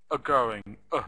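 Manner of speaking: Are shrunk to a short "uh" before going; reduced "are". Shown as a demonstration. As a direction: say Are as a weak schwa.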